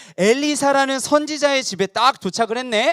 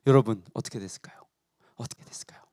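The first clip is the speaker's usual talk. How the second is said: 속삭이는 질문; 13 semitones lower, 6 dB quieter, much faster than usual. Acted. 궁금증 유발 속삭임.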